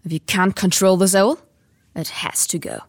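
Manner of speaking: loud, stern scolding uncle. Acted. Voices Uncle Vernon scolding.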